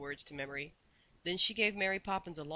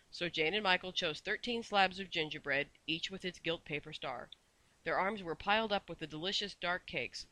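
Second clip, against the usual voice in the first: usual speaking voice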